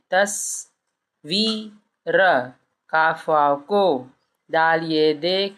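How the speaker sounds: letter by letter, drawn out; slow spelling aloud. Shown as a demonstration. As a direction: spell it slowly for a child. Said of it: "बच्चों को ऐसे बताइए".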